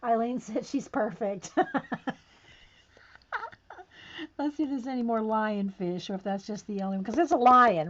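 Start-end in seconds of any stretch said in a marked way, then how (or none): 1.5-2.1 short high chuckle; amused laugh. Real, not acted. Eileen said she's perfect, amused chuckle. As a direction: break into a brief high laugh.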